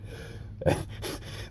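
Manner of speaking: breathy unvoiced chuckle; soft laugh. Real, not acted. Telling viewers about the complainer, a soft breathy chuckle. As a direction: laugh quietly at the irony.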